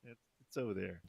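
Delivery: very soft, hesitant mock worker; timid reply. Store worker answering a scary drunk, timid and very soft. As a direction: answer in a small, hushed voice.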